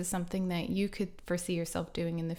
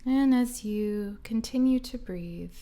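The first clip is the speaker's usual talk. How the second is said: slow guided meditation voice with long pauses; guided relaxation. Acted. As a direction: ease listeners into calm, phrase by unhurried phrase.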